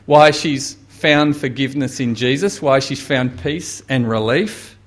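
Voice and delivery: normal talking voice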